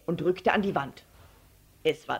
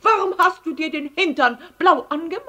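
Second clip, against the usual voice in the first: high, loud, fast question; shocked mother's cry; 10 semitones higher, 8 dB louder, faster than usual. A narrator's reading. Mother questioning Paul about his bottom, shocked cry. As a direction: scold in a high, rushed voice. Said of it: "rief die Mutter".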